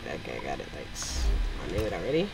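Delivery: sarcastic deep voice. Pretend. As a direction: brush off the tutorial in a deep voice. Answering the game tutorial.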